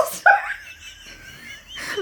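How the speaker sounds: usual speaking voice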